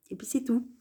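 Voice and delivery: soft whispered sign-off. Real, not acted. Closing her traitor rant in a whisper. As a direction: whisper it like a throwaway end. Wrapping up a cheeky remark.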